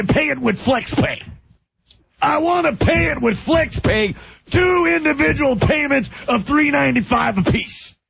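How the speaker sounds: ranting TV pitchman shouting about a bad burger; Don West impression. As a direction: bellow like a furious Home Shopping host in a diner. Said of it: "I can't do the voice".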